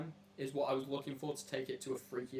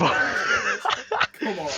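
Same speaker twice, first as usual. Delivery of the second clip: laughing shout; 5 semitones higher, 14 dB louder than usual. Real, not acted. Host laughing, egging friend on.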